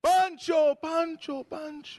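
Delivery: high-pitched call fading with each repeat; parent calling child. Retold or imitated. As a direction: call a child home from far away. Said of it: "O te decía".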